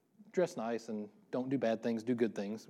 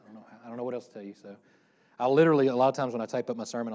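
normal talking voice

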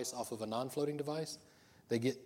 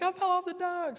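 put-on little girl voice; 4 dB louder than usual. Daughter quoted in dock story.

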